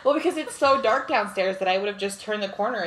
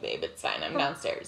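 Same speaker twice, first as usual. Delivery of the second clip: much lower, softer mock husband voice; imitating her husband; 8 dB quieter than usual. Husband calling up to reassure his wife, low mock voice. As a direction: drop into a low calm hubby voice. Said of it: "he called me and was like".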